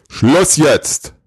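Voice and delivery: loud, booming order from Santa; booming shout. A narrator's reading. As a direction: cut him off with a roar. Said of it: "Mit dröhnender Stimme rief".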